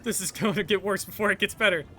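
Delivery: high, loud calling out; shouting. Acted. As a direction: shout after them. Calling after someone.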